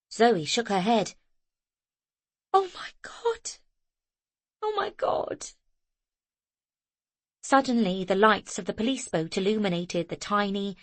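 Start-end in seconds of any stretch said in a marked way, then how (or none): 2.5-5.5 high, crying with relief; sobbing out of sheer relief; tearful disbelief. A narrator's reading. Zoe weeping over the rescued boy.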